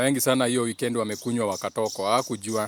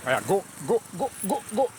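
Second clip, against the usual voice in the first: higher and higher, cheering him on; urging the dog on; 7 semitones higher than usual. Real, not acted. Owner urges his dog across the road, rising excited cheer.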